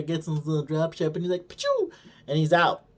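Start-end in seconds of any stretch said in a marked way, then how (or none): 1.5-1.9 very high pew launch noise; mouth zap sound. Retold or imitated. Vader's ship shooting off, high squeaky zap.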